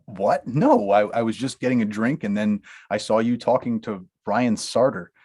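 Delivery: ordinary talk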